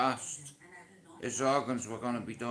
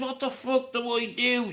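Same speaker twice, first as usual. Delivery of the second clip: panicked; 11 semitones higher, 3 dB louder than usual. Retold or imitated. Panicked on hearing about donor.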